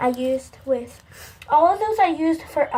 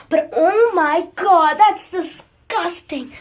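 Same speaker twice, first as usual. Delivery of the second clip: louder, slow, drawn-out disgust; overly dramatic; 4 semitones higher, 7 dB louder, slower than usual. Real, not acted. Girl dramatizing her slime in the toilet for viewers, slow loud disgust. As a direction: play up the horror for the camera. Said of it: "Sorry I'm being so dramatic".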